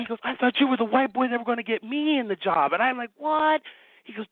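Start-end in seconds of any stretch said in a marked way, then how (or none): 0.2-2.7 excited retelling. Retold or imitated. Excited retold phone call. 3.2-3.6 shocked what. Retold or imitated. Retold shock.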